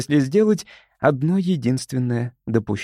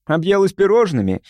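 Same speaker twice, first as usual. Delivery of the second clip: snide, higher-pitched jibe; 7 semitones higher, 3 dB louder than usual. A narrator's reading. Crewmate teasing Лили about overeating, snide. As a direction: needle her with a teasing question. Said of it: "съехидничал Назарян".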